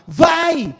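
high, drawn cheer; retold shout. Man retelling how he urged his ex on, mock shouting. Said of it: "porque eu gritava".

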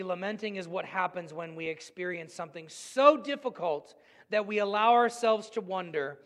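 normal talking voice

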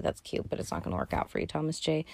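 fast, hushed whisper to Thomas J. Pretend. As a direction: breathe the bad news quickly under your breath. Gently letting Thomas J down.

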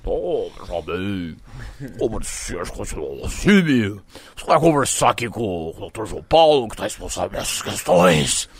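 low, slow, drawn-out impression; exaggerated slow official's drawl; Juvenal. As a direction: stretch the words like a ponderous club president. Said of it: "no presidente do Juvenal".

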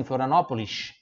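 Florianópolis accent with a hushing final S. Shown as a demonstration. Teacher showing how Florianópolis speaks, local accent. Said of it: "se fala assim".

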